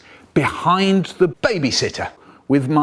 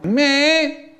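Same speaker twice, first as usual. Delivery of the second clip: very high, tiny squeak of me?; weedy high squeak; weedy little voice; 13 semitones higher than usual. Retold or imitated. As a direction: squeak it out small and guilty. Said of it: "I'm standing there all".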